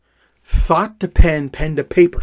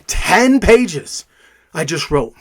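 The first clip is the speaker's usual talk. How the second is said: ordinary talk, nothing marked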